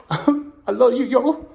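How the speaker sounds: high, loud blubbering declaration; hammed-up crying wail; mock tearful. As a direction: imitate a busted man sobbing out his love. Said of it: "the guy breaks down in tears".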